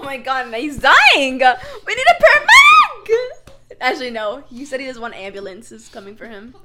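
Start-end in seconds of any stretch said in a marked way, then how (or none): 0.5-3.5 higher, louder laughing shout for help; hammed-up emergency call; mock panic shout.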